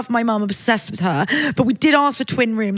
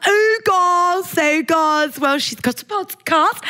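a light Scouse lilt for a proud mum, higher, slow on the last line; mock Scouse mum; 7 semitones higher, much slower than usual. Mimicking her mum's Scouse twang to the podcast hosts. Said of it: "a bit scouse when she gets there".

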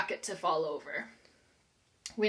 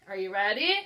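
usual speaking voice

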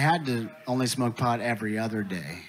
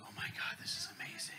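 hushed, unvoiced stoner amazement; breathy stoner awe; 5 semitones lower, 17 dB quieter than usual. Stoner marvels at his magic joint, whispered awe. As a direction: gasp it out in a whisper, holding the hit.

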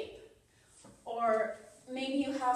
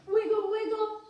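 a loud, higher sing-song wiggle; playful loud wiggle; 7 semitones higher, 7 dB louder than usual. Acted. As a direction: bounce the words for the kids. Wiggling hips with kids.

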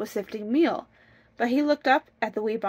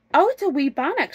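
high, lively storybook wife; raised-pitch, delighted announcement; excited answer; 7 semitones higher, 5 dB louder than usual. Acted. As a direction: exclaim at what has turned up.

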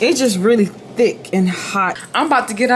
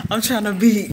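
ordinary talk

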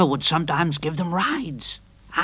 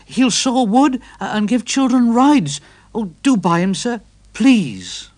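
higher, louder eager pleading; pleading engine; 8 semitones higher, 5 dB louder than usual. A narrator's reading. Edward urging the vicar to buy Trevor, higher and pleading. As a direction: press the case, almost begging.

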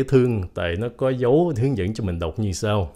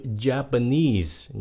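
JAPANESE slowly, as ja-pa-NESE; stress on third syllable; 3 semitones higher, much slower than usual. Shown as a demonstration. Teacher contrasts it with Japan, stressing ja-pa-NESE. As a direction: lean on the last syllable of JAPANESE. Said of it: "nhấn âm ba".

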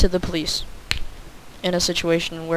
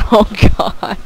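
normal talking voice

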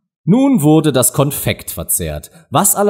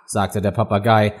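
plain reading voice

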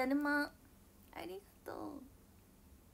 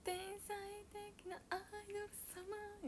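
天才的なアイドル様 in a much higher, softer put-on voice; mock grand idol voice; 9 semitones higher, 11 dB quieter than usual. Shown as a demonstration. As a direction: play the adored idol, sweetly showing off.

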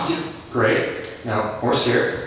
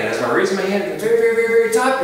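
climbing higher and louder on each very; rising excitement; 7 semitones higher, 4 dB louder, much faster than usual. Acted. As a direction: build up with the hand. Hand rising to the very top.

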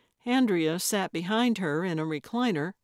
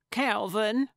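insistent calling of a name, slightly raised; near holler; 3 semitones higher than usual. Acted. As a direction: call out his name, short of a full shout. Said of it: "Gertrude almost hollered".

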